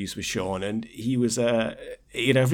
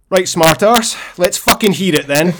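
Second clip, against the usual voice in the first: much louder, higher mock challenge; Sean Connery confrontation; 7 semitones higher, 11 dB louder than usual. Film star confronting his impersonator in the dressing room, loud acted challenge. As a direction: bellow it as an acted star squaring up. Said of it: "Connery looks at Ali, goes right up to him and goes".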